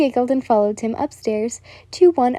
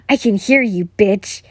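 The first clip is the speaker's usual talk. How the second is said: louder angry yell; 4 dB louder than usual. A narrator's reading. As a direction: snap it back loudly. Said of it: "he yelled".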